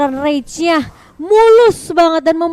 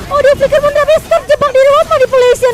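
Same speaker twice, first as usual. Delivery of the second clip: excited; 10 semitones higher, 7 dB louder than usual. Real, not acted. Caster reacting to a teamfight.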